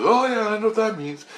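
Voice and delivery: high-pitched, knowing reply; retold eager realization. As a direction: act out someone suddenly catching on. Acting out Christ's excited reply.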